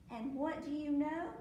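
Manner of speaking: ordinary delivery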